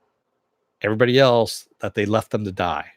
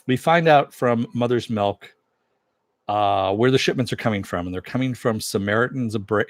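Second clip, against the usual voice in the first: ordinary talk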